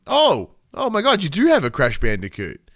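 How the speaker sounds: spotting the friend's Crash figure, he exclaims in real delighted surprise. Real, not acted.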